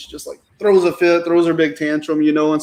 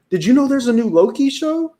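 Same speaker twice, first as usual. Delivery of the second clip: higher, lilting imitation of an eager kid; imitating kid's question; 8 semitones higher than usual. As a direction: voice the kid asking, pitched well up. Retold excited question from his kid.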